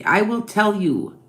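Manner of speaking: usual speaking voice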